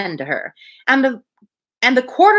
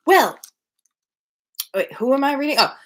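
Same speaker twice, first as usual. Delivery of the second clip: plain reading voice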